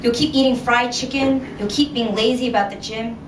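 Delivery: plain reading voice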